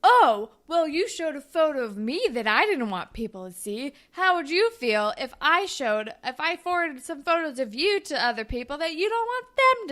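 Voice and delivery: loud "oh", then heated imitated comeback; retold angry outburst. Boy turns on her over the photo, mock angry comeback. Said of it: "he turns into a monster and is like".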